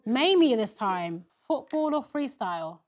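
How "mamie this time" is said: ordinary delivery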